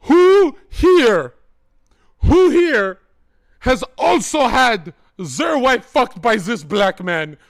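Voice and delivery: loud, high rally cry; mock rally shout; shouting to crowd. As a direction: bellow it at the whole room.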